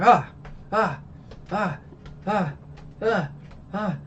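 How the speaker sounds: short repeated mock cries of pain; mock "ah" with each stab; imitated stabbing cries. Nami stabs her tattoo, imitated pained cries.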